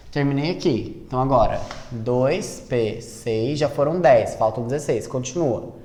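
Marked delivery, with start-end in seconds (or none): 1.9-3.6 ditando devagar. Shown as a demonstration. Professora dita a distribuição.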